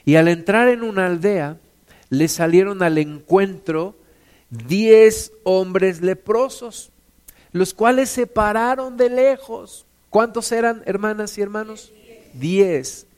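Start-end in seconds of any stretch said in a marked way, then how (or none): none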